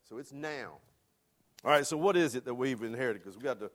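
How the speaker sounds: ordinary talk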